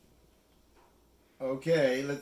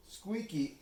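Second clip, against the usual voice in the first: ordinary delivery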